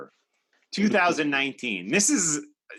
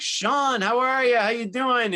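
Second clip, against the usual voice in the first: eager greeting; 9 semitones higher than usual. Retold or imitated.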